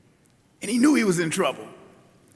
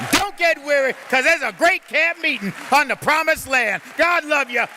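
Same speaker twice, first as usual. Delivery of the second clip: preaching climax; 6 semitones higher than usual. Acted. Bishop rallying the congregation at the close, rousing preaching. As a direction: lift the congregation, full force.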